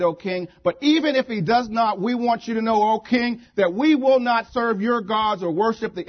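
loud, high declamation; impassioned defiance. Acted. Three condemned men refuse King Nebuchadnezzar, loudly defiant.

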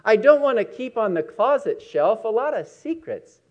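solemn recital to a listening congregation; preacher reads the poem Myself to close the message; poem reading. Acted.

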